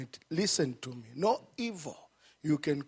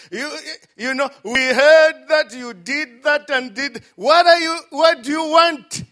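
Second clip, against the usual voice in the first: loud, high, sneering accusation; mocking gossip; 8 semitones higher, 13 dB louder than usual. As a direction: play the judgmental church member shaming him. Church folk scorn returning brother.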